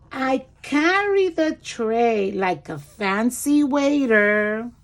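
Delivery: lively storybook read-aloud; reading to children. Acted. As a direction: read it like a picture book for small kids. Reads picture book aloud.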